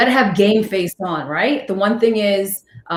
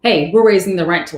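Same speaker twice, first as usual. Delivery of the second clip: usual speaking voice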